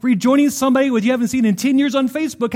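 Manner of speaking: ordinary delivery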